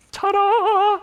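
high, loud showman's reveal; hammed-up grand reveal; mock ta-da. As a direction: unveil it like a cheesy magician. Mock unveiling of the idol.

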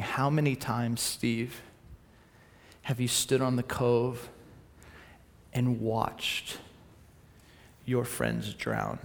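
low, soft and slow, like a still small voice; soft divine whisper. Retold or imitated. God speaking to Steve on the cove, hushed and soft. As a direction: let God whisper it gently. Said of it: "God whisper something to me".

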